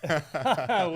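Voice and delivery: a burst of real laughter; bright laugh. Real, not acted. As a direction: laugh out loud, high and loud. Host laughs at guest's joke.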